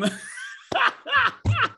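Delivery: high, loud burst of laughter; real high-pitched belly laugh. Real, not acted. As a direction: crack up at your own act. Cracks up at own imitation.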